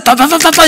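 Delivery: loud, high mock machine-gun patter; rapid-fire mock chatter. Shown as a demonstration. Pastor mocking his own rapid preaching, loud rapid patter. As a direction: rattle it off to mimic fast talk. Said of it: "이렇게 하면".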